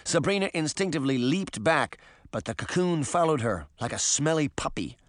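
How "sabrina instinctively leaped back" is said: plain reading voice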